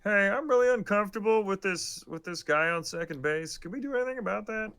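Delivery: high, put-on puppet voice; Kermit. Fan voicing an uneasy player on the field, Kermit impression. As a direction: complain in a high cartoon frog voice. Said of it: "default to the Kermit voice".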